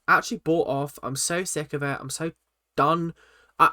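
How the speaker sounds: usual speaking voice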